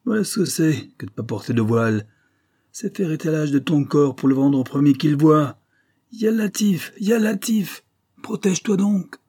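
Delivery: angry veiled woman berating Zara; indignant scolding. A narrator's reading. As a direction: scold her like a moral zealot.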